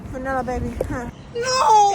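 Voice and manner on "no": loud shout. Pretend. Prank yell in store.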